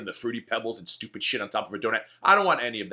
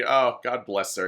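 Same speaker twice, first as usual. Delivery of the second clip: usual speaking voice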